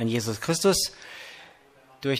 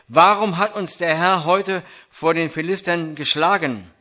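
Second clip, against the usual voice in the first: scripture reading; 3 semitones higher than usual. Acted. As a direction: proclaim the text to the congregation. Reads 1 Samuel 4 aloud.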